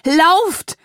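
high, drawn-out desperate yell; bellowed command. A narrator's reading. Mother screaming at her child to flee, bellowed command. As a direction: yell it long and loud, pitch climbing. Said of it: "brüllte Mama".

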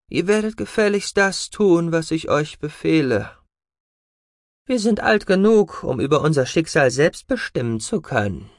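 high, pushing defiance; defiant daughter. Acted. Defiant daughters answer father.

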